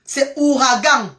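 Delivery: slow, deliberate "ouragan", three clear syllables; corrected pronunciation. Shown as a demonstration. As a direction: insist on "ouragan" as ou-ra-gan, nasal last. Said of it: "je ne prononce pas bien". (« ouragan » articulé lentement, ou-ra-gan)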